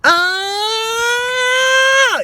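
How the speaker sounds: high, loud compressed ah; compressed scream. Shown as a demonstration. Coach demos glottal compression on an ah. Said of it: "a lot of glottal compression".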